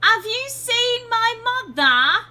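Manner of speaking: high, loud, drawn-out mock British child; child's British accent. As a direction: exaggerate a little British kid's accent, slow and high. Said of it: "I love the fucking accent on the child".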